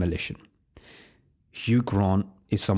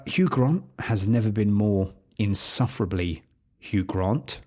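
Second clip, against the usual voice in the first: normal talking voice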